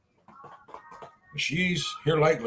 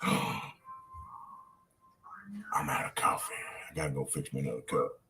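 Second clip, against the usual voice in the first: whispering; 13 dB quieter, faster than usual. Real, not acted. Unvoiced, very soft.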